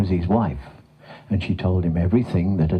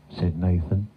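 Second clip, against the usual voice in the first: plain reading voice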